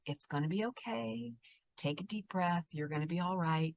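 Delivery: very soft, soothing reassurance; hushed, calming therapist voice; calm low voice. Shown as a demonstration. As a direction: speak gently, settling someone down. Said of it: "if you have a calm, low voice".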